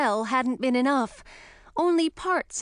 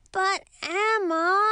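drawn-out higher whine; whining; 9 semitones higher, much slower than usual. A narrator's reading. Young dragon pleads with Emma, drawn-out whine. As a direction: whine it like a sulking kid. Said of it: "Ralph whined".

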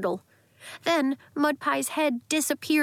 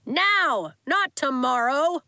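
shouting; 4 semitones higher, 3 dB louder, slower than usual. A narrator's reading. Coach barking orders.

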